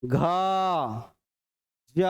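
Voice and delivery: घ and ज each held long; long, echoing vowels on घ and ज; resonant voiced sounds. Shown as a demonstration. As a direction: let घ and ज ring out separately. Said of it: "गूंज रहा है".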